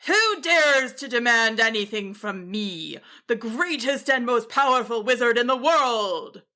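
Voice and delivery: lightly voiced pompous wizard's mild yell; a vain wizard grumbles from his tower; grumbling yell. A narrator's reading. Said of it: "they heard a grumbling yell".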